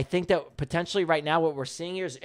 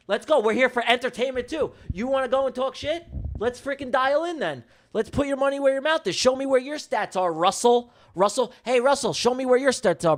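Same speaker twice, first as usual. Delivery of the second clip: high, heated challenge; fired up; 6 semitones higher than usual. Real, not acted. Streamer daring Russell to show his stats, fired up. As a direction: goad the heckler, pitched up and combative. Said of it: "they wanna get me fired up".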